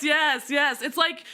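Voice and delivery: ordinary talk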